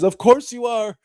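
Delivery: bright high mock Sam, gently comic; eager Sam. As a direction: pitch it up as a devoted, determined Sam. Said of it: "And Sam says".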